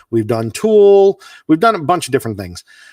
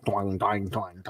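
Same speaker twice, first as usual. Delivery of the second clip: low, much softer twanging mimic; imitating a mouth harp; 8 semitones lower, 16 dB quieter than usual. Co-host mimics the harp in Join Together, low twanging.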